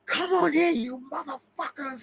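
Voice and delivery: a cornered woman daring the vampires in, then trailing off; mock defiant yell. Imitating the quiet woman's defiant dare to the vampires, high and hammed-up.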